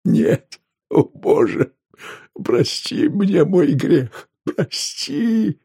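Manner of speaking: high, drawn-out tearful pleading; crying. A narrator's reading. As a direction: beg forgiveness through sobs. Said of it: "Ковач заплакал". (плача, с мольбой в голосе)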